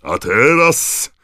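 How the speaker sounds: loud, high, drawn-out stadium call; booming announcer. A narrator's reading. Bagman opening his announcement to the crowd, booming. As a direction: boom it out to the whole stadium. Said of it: "zagrzmiał głos Ludona Bagmana".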